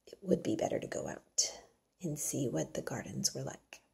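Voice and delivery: hushed, unvoiced inner thought; whispered thought. Mary privately decides to go out, whispered. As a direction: whisper it to yourself.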